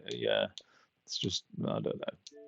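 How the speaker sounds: ordinary talk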